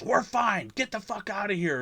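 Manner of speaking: mock irritated call from a tent; man shooing off intruders.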